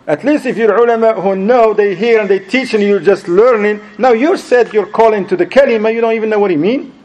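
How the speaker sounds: high-pitched, insistent scolding; exasperated rebuke. Retold or imitated. Scolding a preacher who lacks knowledge of his own message, exasperated. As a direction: argue it hard, building to disbelief. Argues against the ignorant preacher.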